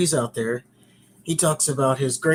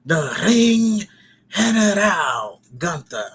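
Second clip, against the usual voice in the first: big booming high-pitched announcer call; hyped-up wrestling introduction; ring announcer; 4 semitones higher, 6 dB louder, slower than usual. Acted. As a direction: draw the name out loud and grand. Hypes up wrestler's name like announcer.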